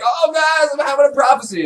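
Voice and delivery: high-pitched dramatic cry; urgent prophetess. Acted. Cassandra announcing a vision to everyone, urgent cry. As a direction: wail it out to get everyone's attention.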